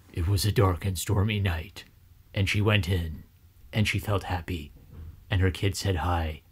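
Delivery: low, soft, monotone audiobook narration; overly serious reader. Retold or imitated. Mocking a bad audiobook reader, overly serious and flat. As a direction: read it gravely in a flat, quiet tone. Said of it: "supposed to be very, very serious all the time".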